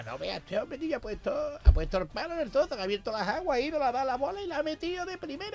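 high, astonished golf spectator; amazed crowd. Acted. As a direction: gush with amazement. Crowd marvels at Moses.

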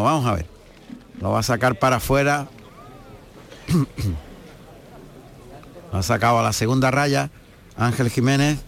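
hoarse, strained commentator voice; rough, worn-out hoarse voice. Real, not acted. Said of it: "pedirle disculpas por la voz". (voz ronca)

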